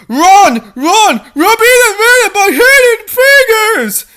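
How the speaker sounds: high, loud shouted warning; alarmed shout. Acted. A voice screams a warning to run. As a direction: yell at everyone to run.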